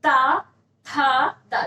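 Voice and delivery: ता, था, दा as separate dental syllables; sound demonstration. Shown as a demonstration. Teacher showing tongue touches teeth, ता, था, दा sounded out separately. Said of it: "दांत से जाके स्पर्श कर रही".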